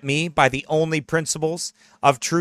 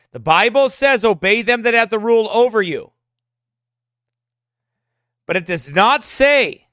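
fervent raised preaching voice; 5 semitones higher, 3 dB louder than usual. Real, not acted. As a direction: preach it with rising fire. Preaching pastor.

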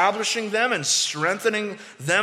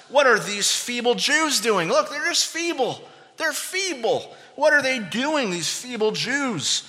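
higher-pitched mocking questions; 6 semitones higher than usual. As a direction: sneer at feeble opponents in a raised pitch. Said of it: "all these mocking kind of questions".

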